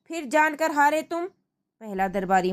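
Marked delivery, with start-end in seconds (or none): 0.1-1.3 mild anger. Acted. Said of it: "क्रोधित".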